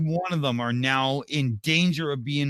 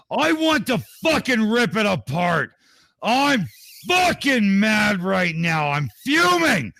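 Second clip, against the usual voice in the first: higher, louder ranting voice, near shouting; Dick Dawson voice; 4 semitones higher, 4 dB louder than usual. Acted. Reading a stuck-in-the-office viewer's comment aloud, furious higher shout. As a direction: rage through it like a furious viewer who can't get a word in. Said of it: "I think this needs the, the Dick Dawson voice".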